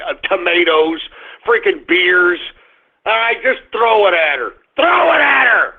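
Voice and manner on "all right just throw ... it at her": angry shout. Real, not acted. Vlogger yelling at Super Bowl fans to throw something, furious shout. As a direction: bellow the order at the crowd.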